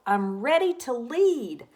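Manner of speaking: loud, proud little declaration; eager shout. Acted. Pup Wilf volunteering to lead the pack, eager shout. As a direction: call out, sure you can lead. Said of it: "shouted Wilf".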